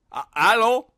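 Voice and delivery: high, loud rising hello; French phone answerer. Acted. Frenchman to unknown caller, loud hammed-up questioning. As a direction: call out comically into the receiver.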